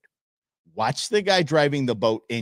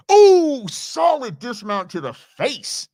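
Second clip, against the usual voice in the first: loud, high "ooh" then slower; wincing reaction; 7 semitones higher, 6 dB louder, slower than usual. Real, not acted. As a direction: react to a painful wipeout. Reacting to a hard fall.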